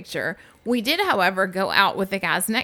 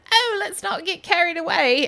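imitating British guy; 10 semitones higher than usual. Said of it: "And he's like".

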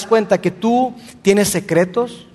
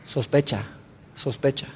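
softly, low and dropped; hushed; 6 semitones lower, 10 dB quieter than usual. Real, not acted. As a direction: let the word sink to a murmur. Naming suspicion in a hushed voice.

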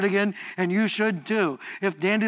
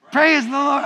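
amped up shout; 8 semitones higher, 7 dB louder than usual. Real, not acted. Congregation amps it up two notches, shouting. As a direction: Praise the Lord shouted high and loud. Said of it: "amp it up about two notches".

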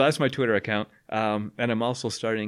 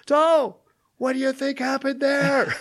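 high, loud yell over deafened ears; 5 dB louder, slower than usual. Retold or imitated. Teacher to class after the explosion, yelling. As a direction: shout it across the room, much higher. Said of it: "I'm yelling in the room".

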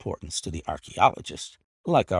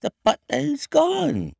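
high, strange, unhinged maid voice; eerie maid; 5 dB louder than usual. A narrator's reading. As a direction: announce it like someone losing her mind. Maid's strange hysterical announcement.

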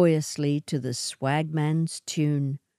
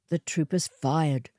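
ordinary delivery, nothing marked

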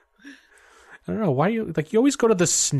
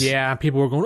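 ordinary talk, nothing marked

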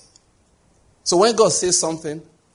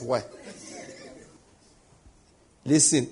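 usual speaking voice

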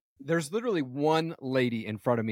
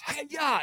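high-pitched karate yelp; sharp rise into a high, playful battle cry; mock ninja kick cry; 12 semitones higher than usual. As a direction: imitate a kid's martial arts kick shout. Acting a child's ninja kick.

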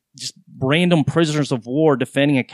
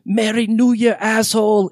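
mock German accent, high and sharp; 8 semitones higher than usual. Exchange student yelling at her ex, in a German accent. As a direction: throw it like a drunk German girl, German accent. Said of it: "in some German accent".

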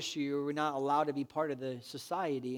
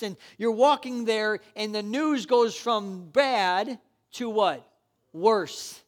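rising to loud, high preaching; building sermon; 6 semitones higher, 9 dB louder than usual. Acted. Pastor urging the congregation, loud high preaching. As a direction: preach it harder and harder to the crowd.

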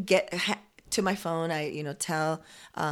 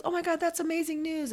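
imitating high-pitched delight; delighted congratulation; 9 semitones higher, 3 dB quieter than usual. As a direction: gush over the good news, voice up high. Retold driver rejoices at news.

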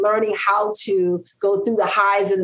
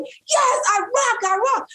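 triumphant cheer; slower than usual. Retold or imitated. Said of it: "We're like".

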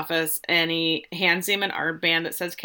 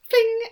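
magic whoosh sound; 4 dB louder than usual. Retold or imitated. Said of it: "all of a sudden it goes".

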